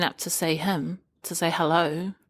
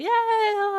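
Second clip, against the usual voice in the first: high, long party whoop; drawn-out, high-pitched mock cheer; mock drunken cheer; 3 dB louder than usual. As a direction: imitate a drunken reveller cheering. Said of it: "when you're drinking ... everyone's drunk".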